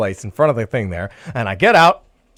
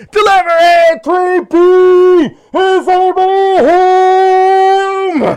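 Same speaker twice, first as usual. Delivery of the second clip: loud, high, drawn-out holler; crazy man hollering up at the building; yelling; 14 semitones higher, 6 dB louder, much slower than usual. Retold or imitated. Driver shouting as mothers are walking by, yelling. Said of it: "I'm yelling up a storm".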